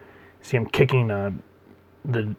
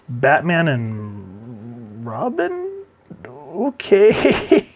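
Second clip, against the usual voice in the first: comic bemusement; 3 semitones higher, much slower than usual. Real, not acted. Reader meeting Batman and Robin, comically bemused. Mock introduction of Batman kick.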